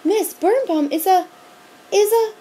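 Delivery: loud, high stammering alarm; too scared to find the word; girl shouting at the monster teacher. A narrator's reading. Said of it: "shouted Sherry Shackmeyer".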